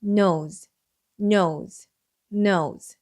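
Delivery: nose said clearly, repeated; model word. Shown as a demonstration. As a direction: say nose slowly and clearly for students. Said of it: "코라는 단어가 됩니다". (또박또박 반복하는 nose)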